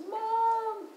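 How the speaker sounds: put-on high child's cry of Mom; little girl's voice. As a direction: call out like a small girl found hiding. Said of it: "This little voice goes".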